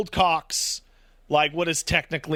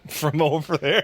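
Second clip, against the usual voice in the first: laughing. Real, not acted. Joking about getting flushed.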